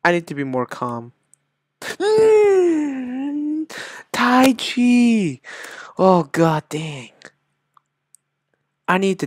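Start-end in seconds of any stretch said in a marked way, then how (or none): 1.8-5.4 high, drawn-out hum and chant; mock meditation. Gamer trying to be calm, mock meditation. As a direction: intone it like a mock calming exercise. Acts calming Tai Chi.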